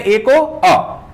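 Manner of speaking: अ drawn out high and slow, a read as a short uh; teacher showing the weak sound of a. Shown as a demonstration. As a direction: stretch out the uh sound on अ. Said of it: "तो क्या बोलते हैं?".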